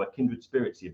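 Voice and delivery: ordinary delivery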